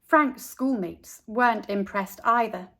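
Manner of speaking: ordinary delivery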